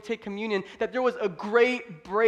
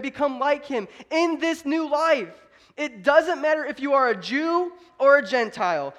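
high, loud scripture proclamation; preaching scripture; 6 semitones higher, 5 dB louder than usual. Real, not acted. Preacher reading Paul's letter to church, preaching it forcefully. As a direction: proclaim the verse to the congregation.

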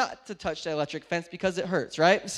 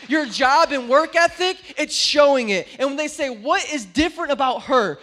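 much louder building preaching; preaching at full force; 5 semitones higher, 12 dB louder than usual. Acted. Preacher urging the congregation to live it out, full-force preaching. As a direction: build to a climax.

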